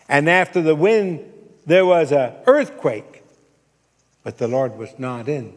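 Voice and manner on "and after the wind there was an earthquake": very loud, dramatic scripture telling; booming preaching. A narrator's reading. Preacher telling the congregation of the earthquake, booming. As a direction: hurl it out at full force.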